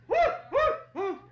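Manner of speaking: very high loud hooting whoops; stork's loud whoops. Acted. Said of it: "the stork again went".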